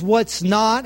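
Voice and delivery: ordinary talk, nothing marked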